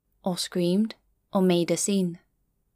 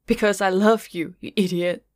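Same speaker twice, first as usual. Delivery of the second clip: ordinary delivery, nothing marked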